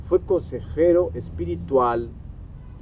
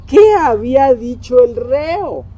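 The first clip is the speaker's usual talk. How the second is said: mock interrogation; 9 semitones higher, 7 dB louder than usual. Cortés questioning the friar after confession, mock interrogation. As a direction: loudly demand an answer, pitch pushed up. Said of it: "Cortés le preguntó al fraile".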